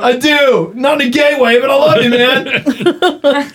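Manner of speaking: put-on hammy drunk declaring love; drunk friend shouting. Drunk friend hugging, locking arms.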